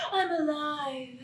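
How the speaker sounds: awed hush. Acted. Repeated after laughing.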